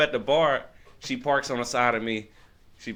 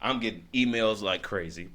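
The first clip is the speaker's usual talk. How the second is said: ordinary talk, nothing marked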